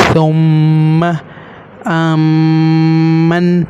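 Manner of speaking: ثم and آمن slowly with the m held long; held doubled m. Shown as a demonstration. Teacher demonstrating heavy sounds, long m in ثم and آمن. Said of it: "موٹا کیسے کرنا ہے اور بھاری کیسے".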